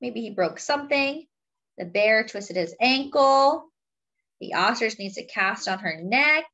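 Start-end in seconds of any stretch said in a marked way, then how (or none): none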